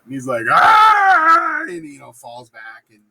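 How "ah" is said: high, loud comic scream; mock freak-out scream. Macbeth freaking out publicly at Banquo's ghost, hammed-up scream. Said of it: "a very public freak out".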